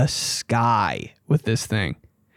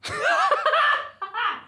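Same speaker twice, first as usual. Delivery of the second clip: high squawking laugh. Retold or imitated. Seagull hit by bread.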